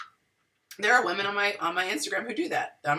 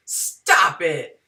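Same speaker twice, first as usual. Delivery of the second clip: condescending; 3 semitones lower, 6 dB louder than usual. Retold or imitated. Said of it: "he's real condescending".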